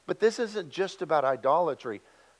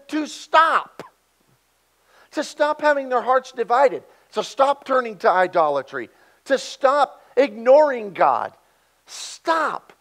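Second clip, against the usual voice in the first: higher, louder, each stop pushed harder; begging them to stop, fed up but loving; pleading exasperation; 7 semitones higher, 9 dB louder than usual. Acted. Preacher voicing God begging Israel to stop, exasperated plea. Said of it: "a pleading patience laced with exasperation".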